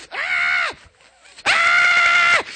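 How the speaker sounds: very high mock infant wail; piercing imitation baby cry; imitated baby crying. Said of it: "he would be crying and drinking".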